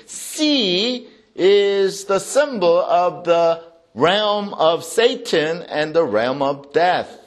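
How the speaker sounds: usual speaking voice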